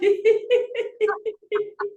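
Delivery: bright burst of giggling; high giggle. Real, not acted. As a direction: let a real giggle out. Laughter yoga session.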